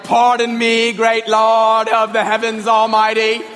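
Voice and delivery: hammed-up King James solemnity, loud sustained monotone; pompous praying voice. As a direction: intone a mock-pious, archaic prayer. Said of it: "they speak in a different voice even".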